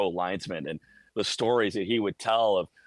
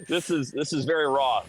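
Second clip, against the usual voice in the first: laughing; 6 semitones higher than usual. Real, not acted. Laughing at the audio testing.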